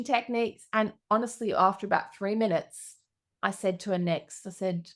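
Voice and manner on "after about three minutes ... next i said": ordinary talk, nothing marked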